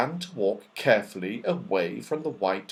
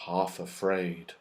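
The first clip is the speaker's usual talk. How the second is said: plain reading voice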